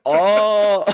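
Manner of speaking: long, loud, high-pitched mock "oh"; retold realization. Pierre finally getting what shart means, mock amazed. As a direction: draw out a big exaggerated dawning realization. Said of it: "And he's like".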